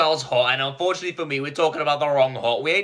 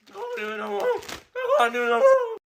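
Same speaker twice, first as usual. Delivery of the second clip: high, strained wailing; anguished. Real, not acted. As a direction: cry out in defeat. Defeated by spicy challenge.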